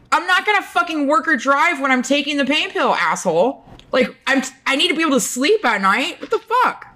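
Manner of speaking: high-pitched, exasperated comeback; indignant retort. Retold or imitated. Retold angry retort to doctor.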